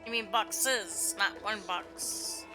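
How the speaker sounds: ordinary talk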